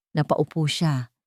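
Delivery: usual speaking voice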